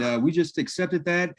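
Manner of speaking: ordinary talk, nothing marked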